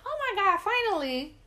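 high imitated relief; overjoyed stepmom. Stepmom learning of the pregnancy, mock overjoyed. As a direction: exclaim it high, as if long awaited.

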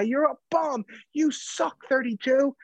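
put-on high, jeering old man; old heckler. Old fan heckling Jay Crowder, high mocking jeer. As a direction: sneer it like a cranky old fan. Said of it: "this is just guy".

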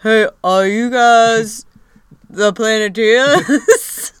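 slow, drawn-out mock Australian accent; a half-hearted Australian accent, taking its time; lazy Australian accent. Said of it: "wasn't even doing a decent job".

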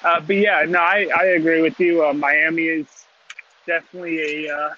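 choppy call audio; bad connection. Real, not acted. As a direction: voice breaking up over the phone. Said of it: "you're breaking up".

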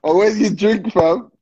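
high, loud call to the guest. Real, not acted. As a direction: call out across the stream. Calling to a guest on stream.

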